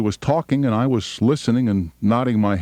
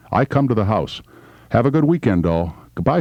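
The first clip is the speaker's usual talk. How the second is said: ordinary talk, nothing marked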